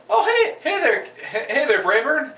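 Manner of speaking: high bright mock-friendly hello; cheerful greeting. Character greets Brayburn in a high cheery mock voice. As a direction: chirp it like an overeager neighbour. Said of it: "they go like".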